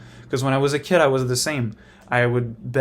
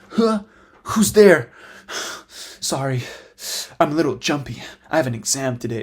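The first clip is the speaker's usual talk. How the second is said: anime guy voice. Retold or imitated. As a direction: overact a nervous anime hero. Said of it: "those guys that talk as if they're in an anime".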